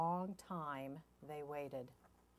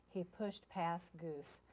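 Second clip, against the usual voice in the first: plain reading voice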